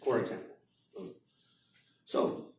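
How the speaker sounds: ordinary talk, nothing marked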